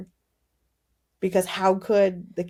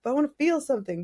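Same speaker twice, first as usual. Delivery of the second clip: salty complaint; 6 semitones higher, 4 dB louder than usual. Retold or imitated. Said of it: "I was so salty with that".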